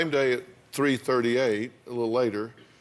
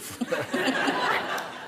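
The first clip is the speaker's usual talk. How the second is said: breathy laugh; 12 semitones higher than usual. Real, not acted. Host laughs at own remark.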